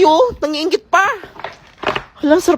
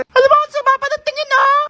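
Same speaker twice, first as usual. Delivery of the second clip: put-on high kid voice tugging at mom; high child voice; envious child; 11 semitones higher, 4 dB louder than usual. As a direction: whine to mom for food.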